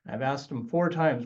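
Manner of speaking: ordinary talk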